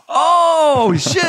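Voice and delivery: high, loud mock astonishment; shocked wife. Wife seeing the news, hammed-up shock. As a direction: imitate a wife's stunned realization. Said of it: "and she's like".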